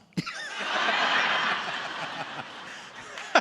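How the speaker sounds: high cackling laugh at his own joke; real laughter bursting out. Real, not acted.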